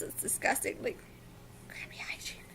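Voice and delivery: disgusted whisper. Real, not acted.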